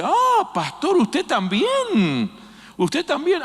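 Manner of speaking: high, astonished cry of disbelief, stretching out también; a stunned voice, amazed the pastor is helping; surprised neighbor. Retold or imitated. Neighbor amazed to see the pastor fighting the fire, high surprised cry.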